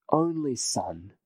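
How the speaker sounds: ordinary talk, nothing marked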